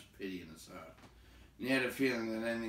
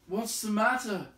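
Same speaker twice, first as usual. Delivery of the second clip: calling out; 12 semitones higher, 9 dB louder than usual. Acted. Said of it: "Bilbo called".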